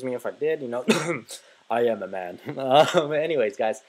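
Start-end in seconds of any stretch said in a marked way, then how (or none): 1.7-2.4 low, put-on macho voice; mock manly voice. Gamer defending himself after a voice crack, mock manly. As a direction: drop the pitch in joking manliness.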